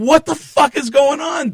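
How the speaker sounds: high, loud, stretched-out disbelief; incredulous outburst. Retold or imitated. Comedian reliving his astonishment, incredulous outburst. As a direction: exclaim it in utter amazement. Retold amazement at the recall.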